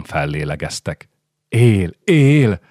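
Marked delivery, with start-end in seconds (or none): 1.5-2.6 higher, louder cry of relief; joyful exclamation. A narrator's reading. Women seeing the newborn live, excited exclamation. Women see the baby lives.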